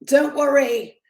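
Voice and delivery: a hint of a sprawled drunk reassuring his maid; mock Thomas Shelby line, no accent; imitating Thomas Shelby. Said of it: "saying to his maid".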